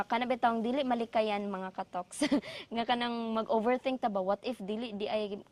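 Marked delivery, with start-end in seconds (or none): none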